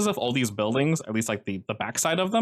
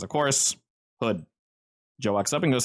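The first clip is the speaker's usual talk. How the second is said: ordinary delivery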